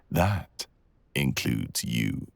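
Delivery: ordinary delivery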